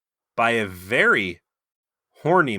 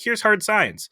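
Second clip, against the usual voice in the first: lightly acted higher-pitched announcement; voiced museum pitch; 12 semitones higher, slower than usual. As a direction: pitch it up as a mock presenter. Voicing one museum's pitch.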